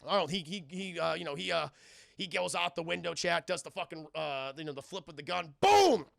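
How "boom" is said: big high, loud boom; imitated gunshot. Telling chat of the flip with the gun, booming blast.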